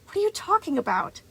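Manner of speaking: put-on high, exasperated boy; annoyed. A narrator's reading. Boy challenges his accuser, high-pitched and annoyed. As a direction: throw the question back high and indignant. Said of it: "a strange flare of annoyance".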